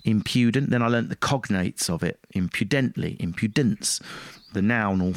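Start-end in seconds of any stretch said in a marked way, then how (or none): 0.0-0.7 impudent with the weight on IM, rest light; stress on im. Shown as a demonstration. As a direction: mark the IM of impudent clearly. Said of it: "I had a dash on the im".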